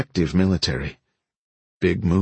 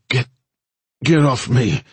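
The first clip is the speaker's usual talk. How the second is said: higher, louder slurring growl; slurred growl; 6 semitones higher, 4 dB louder than usual. A narrator's reading. Wounded woman pushing off her rescuer, slurred growl. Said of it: "Nola growled, her words slurring".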